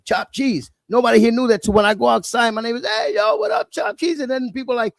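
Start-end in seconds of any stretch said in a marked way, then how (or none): 2.9-4.2 high, hyped street greeting, calling across; neighbor calling out. Retold or imitated. Neighbors greeting him outside with a high excited call. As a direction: hail him like a neighbor spotting a friend.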